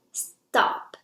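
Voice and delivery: "stop" dropped sharply from high to low; short, loud, falling "stop". Shown as a demonstration. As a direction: say "stop" like a firm falling command. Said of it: "The fourth tone is a falling tone".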